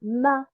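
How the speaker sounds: "ma." said slowly and clearly with an open a; teaching "ma." with a short fatha vowel; syllable with fatha. Shown as a demonstration. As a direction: demonstrate "ma." as a teacher would. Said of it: "je prononcerai cette lettre".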